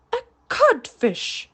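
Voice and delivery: stunned, slow, high repetition; broken captain repeating the insult; blank echo. A narrator's reading. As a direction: echo it without understanding. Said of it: "Hook echoed blankly".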